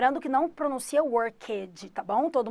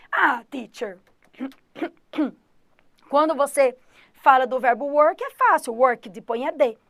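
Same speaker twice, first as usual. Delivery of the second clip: student voice; 7 dB louder than usual. Retold or imitated. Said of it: "Aí você pergunta".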